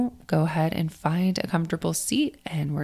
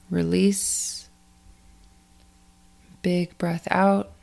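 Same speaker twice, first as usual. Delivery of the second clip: guided meditation; 4 dB quieter than usual. Acted. Coach guides athletes to let go of last week, in a guided meditation voice. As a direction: ease listeners through the breathing, unhurried and spacious.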